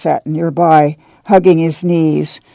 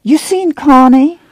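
demanding; 10 semitones higher than usual. A narrator's reading. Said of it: "Rose of Sharon demanded".

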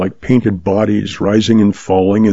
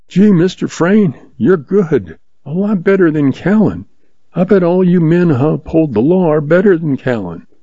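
put-on young boy voice; 7 semitones higher than usual. Eager boy speaks to hero.

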